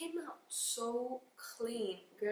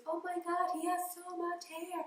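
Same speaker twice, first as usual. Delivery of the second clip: high excited gush, much higher and louder; amazed nurses; 8 semitones higher, 3 dB louder than usual. Retold or imitated. Nurses seeing baby's hair, high excited gush. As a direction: gasp it out in delight. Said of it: "the doctor and the nurses".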